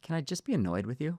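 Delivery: wife asking quietly to just be annoyed; wife gently asking her husband for room; soft mock plea. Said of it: "my wife goes".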